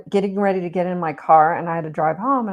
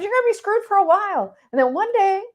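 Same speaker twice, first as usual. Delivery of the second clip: friend's blunt verdict; 12 semitones higher, faster than usual. Retold or imitated. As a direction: ham up the friend's blunt answer, pitched up. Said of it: "He goes".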